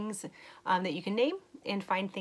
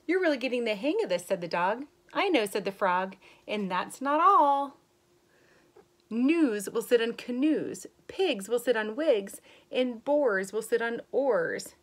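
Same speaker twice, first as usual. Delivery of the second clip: put-on slow, lilting read-aloud voice for kids; story reading; 4 semitones higher, 7 dB louder than usual. Teacher reading a rhyming book to young kids, lilting. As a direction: read the rhyme brightly to the class. Reads picture book to children.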